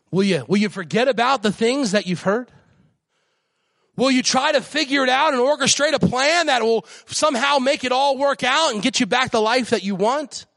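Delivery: high, ringing preacher's cry; preaching at full force. Real, not acted. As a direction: lift the church up with every line.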